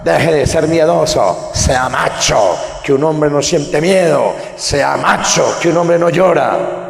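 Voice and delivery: put-on loud, harsh commands to a crying boy; stern father scolding. Priest voicing the military father ordering his son to be a man, loud and harsh. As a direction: bark orders at a child to toughen up.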